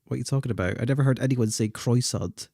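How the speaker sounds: ordinary talk, nothing marked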